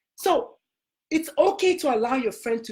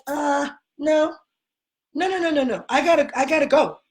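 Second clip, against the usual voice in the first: Jesus saying no; 4 semitones higher than usual. Retold or imitated. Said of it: "He was like".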